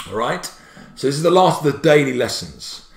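usual speaking voice

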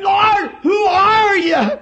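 high, loud, slow cry; Saul's cry. Acted. Saul in the dust crying up to the voice. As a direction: call up in awe and fear. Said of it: "Perhaps he said".

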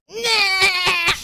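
loud high bleat-like yell; hammed-up angry sheep cry; sheep scream imitation. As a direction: mimic the sheep screaming at you. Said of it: "this thing goes".

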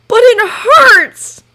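high, loud, slow tearful wail. A narrator's reading. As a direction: plead through tears. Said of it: "Tears fell from his cheeks".

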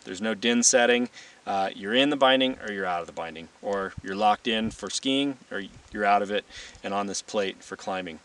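ordinary talk

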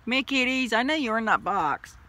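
high loud coaxing call; coaxing pets. Real, not acted. Coaxing kittens from box.

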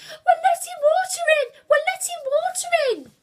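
very high, loud panicked shriek; screaming. A narrator's reading. Lucia spots the leak, screaming. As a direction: scream it in alarm, repeatedly. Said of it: "Lucia started screaming".

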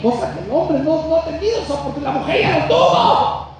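full-force preaching. Acted. Preacher shouting a blessing over women to the congregation, full force. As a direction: thunder it from the pulpit.

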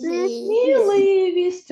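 slow, high, syllable by syllable; drawn-out word. Shown as a demonstration. Teacher to pupils, reading the word slowly and high. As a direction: stretch each syllable of Сміливість for the class. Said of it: "а тепер прочитаємо наше слово".

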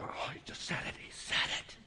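hushed scandalized whisper; mock gossip whisper. Churchgoers whispering when the pastor says predestined, mock-scandalized. Congregation gossiping over 'predestined'.

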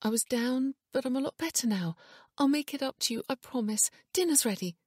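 high-pitched, anxious reassurance; panicky. A narrator's reading. Wife promising to make it up to Ian, panicky. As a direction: pitch up, pleading to smooth things over. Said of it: "panic in her voice".

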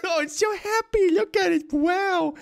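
high, giggly coo at the creature; gushing with laughter; delighted high-pitched squeal. Real, not acted.

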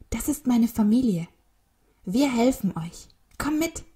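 high, excited rallying; rally a friend to join in; rousing call. A narrator's reading. Whale rallies the albatross to help.

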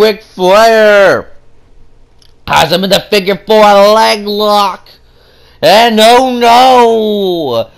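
dramatic play-by-play. Acted. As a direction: sell the peril like an announcer. Dramatic commentary.

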